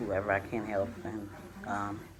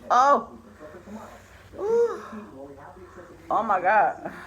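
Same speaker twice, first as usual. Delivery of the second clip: spicy burn; 8 semitones higher than usual. Real, not acted. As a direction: gasp out the heat. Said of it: "That sauce is hot".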